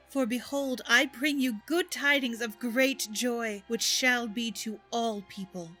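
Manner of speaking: ordinary delivery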